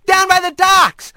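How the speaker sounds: shout. Acted. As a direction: call it out down the street. Rat shouting warning through town.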